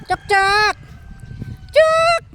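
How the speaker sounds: high loud call across the yard; calling the dog. Real, not acted. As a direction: shout the dog's name to call it over. (malakas na pagtawag sa aso)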